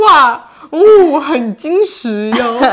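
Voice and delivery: high, loud, very slow mock compliment; sarcastic praise. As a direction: drag out fake praise of the muscles. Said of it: "我用反讽法". (拖长的反讽夸奖)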